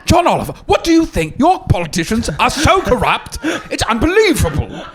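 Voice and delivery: high, mocking British host impression; mock John Oliver. Comic mocking a foreign TV host, high mock voice. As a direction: lampoon a smug foreign host. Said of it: "Or my least favorite is Trevor Noah".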